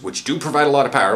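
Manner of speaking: ordinary talk, nothing marked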